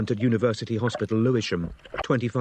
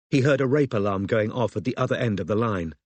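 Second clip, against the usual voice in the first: usual speaking voice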